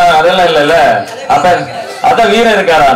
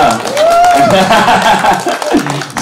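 long delighted burst of laughter; 6 semitones higher than usual. Real, not acted. Host hearing a fan learned Tamil, laughing. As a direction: laugh out in quick pulses.

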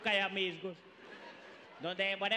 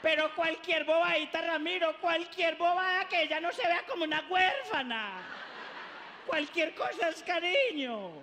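higher and louder pleading mom; insistent mother; 6 semitones higher, 3 dB louder than usual. Acted. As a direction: push the husband to give in.

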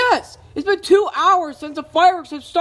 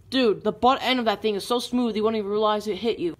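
ordinary talk, nothing marked

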